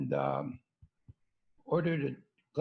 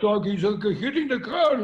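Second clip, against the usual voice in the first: imitating excited cab driver; 5 semitones higher, 8 dB louder than usual. Puerto Rican cab driver complaining.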